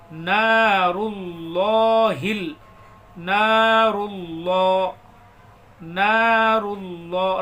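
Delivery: نار اللہ with the ra run straight into the lam, very slow; slow chanted نار اللہ, ra flowing into lam; نار اللہ drawn out, ra merged with lam; ra joined to lam. Shown as a demonstration. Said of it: "را کو لام میں ملائیں گے".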